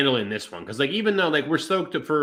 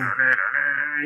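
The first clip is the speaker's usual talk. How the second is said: imitated entrance music; much slower than usual. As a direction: hum a wrestler's entrance tune. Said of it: "That was pretty good".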